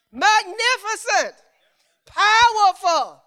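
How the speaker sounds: high, loud, stretched praise words; proclaiming. Acted. Preacher feeds praise words to congregation, proclaiming loudly. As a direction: ring each word out slowly.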